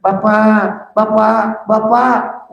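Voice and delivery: high, drawn-out calls to Dad; calling out for father. Acted. Children calling their unresponsive dying father, insistent high calls. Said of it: "Anak-anak kita pada manggil-manggil kita".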